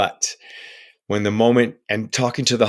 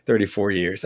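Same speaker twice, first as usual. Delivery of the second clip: ordinary talk, nothing marked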